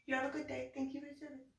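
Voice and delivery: high, soft, through a laugh; giggly mock politeness. Imitating goodbye to police officer, giggly politeness. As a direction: put on a small polite voice while giggling.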